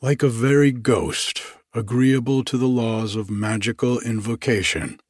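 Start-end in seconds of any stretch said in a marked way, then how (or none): none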